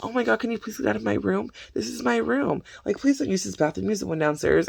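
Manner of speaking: high-pitched, put-upon pleading; exasperated pleading. Retold or imitated. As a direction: imitate a flustered wife begging people out. Acting out wife pleading with intruders.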